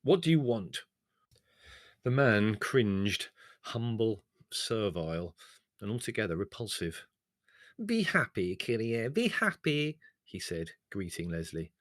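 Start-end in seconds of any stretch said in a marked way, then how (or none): 0.0-0.8 belligerent. Acted. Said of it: "said Leslie belligerently". 7.8-10.0 high, fawning greeting; bow and scrape with the words; servile greeting. Acted. The cringing turkey owner greets Leslie: high, fawning.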